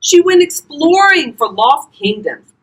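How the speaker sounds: usual speaking voice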